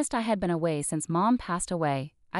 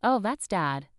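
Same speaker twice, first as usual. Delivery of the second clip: ordinary delivery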